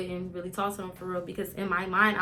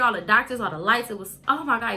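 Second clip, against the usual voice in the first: ordinary delivery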